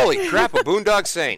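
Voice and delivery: high loud excited exclaim; starstruck. Real, not acted. Spotting the actor, high loud starstruck gush. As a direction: gush over the guest.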